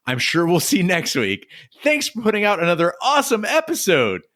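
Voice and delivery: high-pitched, over-cheerful sign-off; mock-gushing fan thanks; all sunshine and rainbows. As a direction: end the letter in a high, sunny singsong. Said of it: "suddenly it's all sunshine and rainbows".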